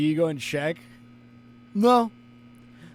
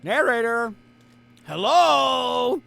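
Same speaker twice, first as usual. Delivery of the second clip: high, raised call for someone absent; 6 semitones higher, 4 dB louder, much slower than usual. Pretend. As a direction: call out, rising into a questioning hello. Calling out to absent game narrator.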